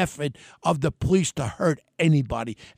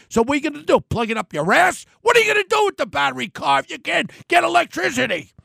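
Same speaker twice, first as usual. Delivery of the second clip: venting rant; 8 semitones higher, faster than usual. Real, not acted. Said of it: "I'm just venting right now".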